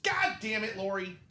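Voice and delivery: high-pitched, exasperated dad impression; imitating yelling dad. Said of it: "heard my dad say that to my mom".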